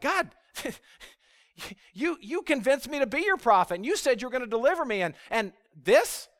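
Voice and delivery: high, loud incredulous complaint; exasperated disbelief. Acted. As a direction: protest to God, acting out the betrayed prophet. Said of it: "And Jeremiah's like".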